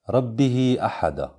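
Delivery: slow "ربه أحد", stretched to rabbiheee ahada; long connecting madd. Shown as a demonstration. Teacher reads a major connection example, "ربه" with long held ee. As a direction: hold the final ee of "ربه" before "أحد". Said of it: "الحكم التجويدي صلة كبرى".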